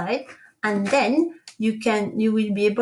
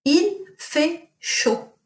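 Il fait chaud repeated very slowly and higher; teacher repeating Il fait chaud for pupils; 7 semitones higher, much slower than usual. Shown as a demonstration. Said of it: "what does it mean if I say".

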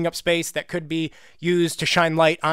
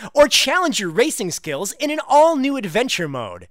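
higher-pitched, overhyped ad announcer; hammed-up commercial voiceover; crappy commercial read; 8 semitones higher, 3 dB louder than usual. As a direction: sell it like a cheesy TV spot. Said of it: "I'll read this as if it's, like, some crappy commercial".

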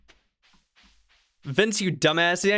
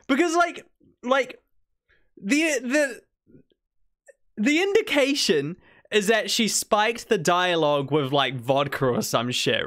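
usual speaking voice

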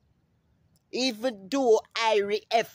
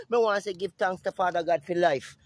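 normal talking voice

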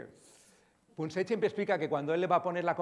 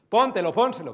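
loud, curt command; bossy order; 3 semitones higher, 13 dB louder than usual. Retold or imitated. Speaker imitating old health campaigns giving orders to youth, loud and bossy. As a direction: bark it as an instruction to teens. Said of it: "Nos salían órdenes".

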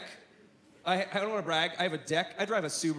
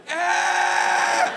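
long high piercing shriek, very loud; squirrel's endless high scream; 9 semitones higher, 8 dB louder than usual. Retold or imitated. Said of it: "it just screamed".

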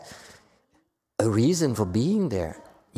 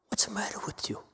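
scolding whisper; 7 semitones higher, 11 dB quieter, faster than usual. Real, not acted. Scolding aside to students.

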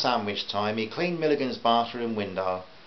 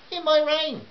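very high put-on woman's voice; high-pitched fussy customer; objecting housewife; 3 dB louder than usual. A narrator's reading.

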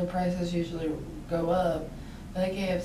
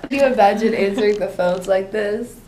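laughing; 7 dB louder than usual. Real, not acted. Laughing at her voice.

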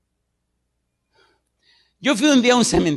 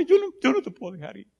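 quick, softer pleading widow; clinging widow; 7 semitones higher, 4 dB quieter, faster than usual. Retold or imitated. Preacher voicing a widow holding her dead husband, softly pleading. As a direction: hurry the words out in grief. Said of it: "le decía".

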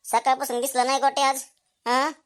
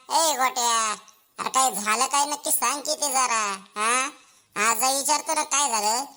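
ordinary talk

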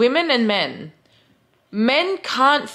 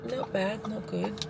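ordinary talk

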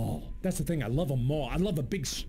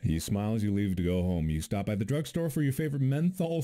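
usual speaking voice

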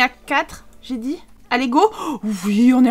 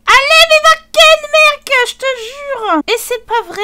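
much higher and louder, pleading yell; exasperated shout; 12 semitones higher, 11 dB louder than usual. Real, not acted. Gamer screaming at his Sim to get on with it, exasperated. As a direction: shout at him, completely fed up.

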